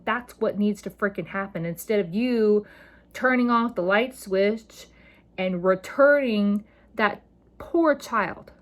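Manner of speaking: usual speaking voice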